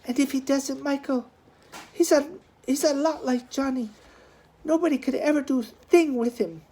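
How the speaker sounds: high, coaxing plea; wheedling. A narrator's reading. Sister coaxing her fiancé about her brother, high wheedling. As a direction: wheedle him gently. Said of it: "Noreen's voice was wheedling".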